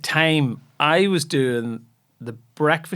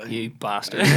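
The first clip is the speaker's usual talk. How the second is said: mock grudging curse; 3 semitones lower, 3 dB quieter than usual. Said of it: "I just go".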